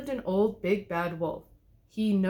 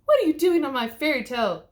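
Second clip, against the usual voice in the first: loud, higher scolding; 6 semitones higher, 7 dB louder than usual. A narrator's reading. Mommy Bear scolding the wolf in her chair, loud and high. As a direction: scold like an upset mother. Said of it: "scolded Mommy Bear".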